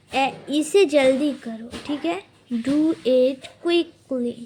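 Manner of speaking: ordinary delivery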